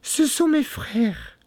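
very high cry of relief; exclaiming. A narrator's reading. Wife hearing of rescuers, high relieved cry. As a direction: cry out thankfully in falsetto. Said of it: "s'écria-t-elle un moment après".

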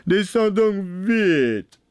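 slow, drawn-out impatient holler; shouting. A narrator's reading. Bluebeard ordering his wife down, shouting. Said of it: "criait la Barbe Bleue".

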